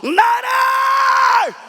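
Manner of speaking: very high, very loud, drawn-out cry; loudest shout. Acted. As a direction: stretch every word into a full-voiced scream for mercy. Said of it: "가장 큰 목소리로". (가장 큰 목소리로 길게 외치기)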